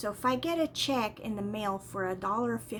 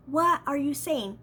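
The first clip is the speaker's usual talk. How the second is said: ordinary talk, nothing marked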